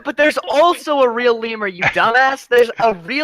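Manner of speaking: high-pitched, exasperated insistence; insistent arguing. Real, not acted. Defending the real lemur to co-host, exasperated insistence. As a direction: argue back, voice pushed up high. Heated argument about lemur.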